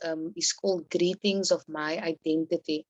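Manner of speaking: ordinary talk, nothing marked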